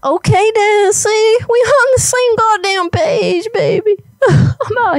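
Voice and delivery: high, eager exclamation; hammed-up excitement. Caller tells Elizabeth they are on the same page, high excited delivery. Prank caller delighted at agreement.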